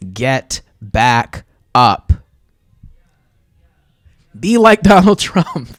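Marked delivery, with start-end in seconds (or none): 4.4-5.8 loud and high, laughing through it; laughing joke. Real, not acted. Streamer joking to chat, loud and laughing.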